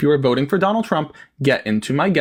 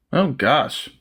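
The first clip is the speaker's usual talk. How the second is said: ordinary talk, nothing marked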